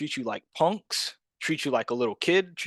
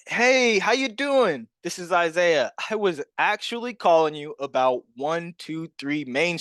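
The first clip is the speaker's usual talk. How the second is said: high-energy phone greeting; 4 semitones higher, slower than usual. Shown as a demonstration. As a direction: hit them with big sales enthusiasm. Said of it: "That's literally how I sound".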